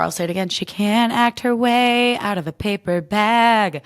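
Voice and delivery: ordinary delivery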